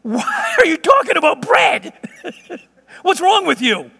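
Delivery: high, incredulous mock shout; exasperated outburst. Jesus scolding his disciples about bread, exasperated outburst. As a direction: burst out as if they are hopeless.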